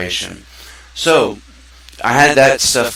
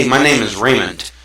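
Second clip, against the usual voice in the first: bright, louder, sure of yourself; confident self-introduction; 4 dB louder than usual. Shown as a demonstration. Teaching kids to speak, a confident hello. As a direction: greet them like you mean it. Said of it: "or do I say".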